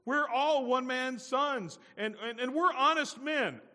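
high, loud, drawn-out plea; hammed-up protesting innocence; earnest protest. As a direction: insist loudly and slowly on your honesty. Brothers protest their honesty.

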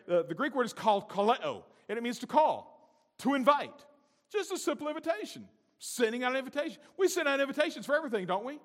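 loud, high, driving sermon voice; preaching at full force. Acted. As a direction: whip the congregation up.

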